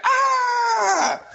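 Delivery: high mock scream. Mother bursts in on them, high mock scream. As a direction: shriek like a startled mother. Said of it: "she starts screaming".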